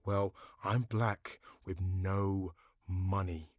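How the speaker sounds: normal talking voice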